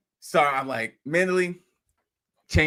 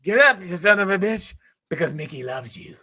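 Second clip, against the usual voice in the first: Mick's line; 3 semitones higher than usual. Retold or imitated. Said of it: "Mick was saying something".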